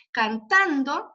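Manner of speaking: "Cantando" drawn out slowly, weight on TAN; stretched accented syllable. Shown as a demonstration. Teacher marking the accent for students, slow stressed TAN in "Cantando". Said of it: "entonces le ponemos acento".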